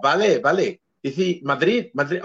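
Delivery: put-on Spanish teacher's accent. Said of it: "when he was like this".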